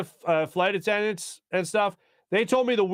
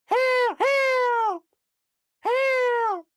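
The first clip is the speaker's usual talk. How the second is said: peacock call; much slower than usual. Retold or imitated. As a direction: screech it like a peacock. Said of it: "hearing this through the duration of the flight".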